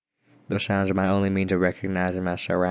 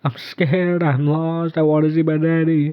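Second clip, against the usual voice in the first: put-on high, louder, scared child voice; frightened ghost girl; 9 semitones higher, 5 dB louder than usual. A narrator's reading. Lost ghost girl begging to see her daddy, scared and high. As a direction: plead like a lost little girl.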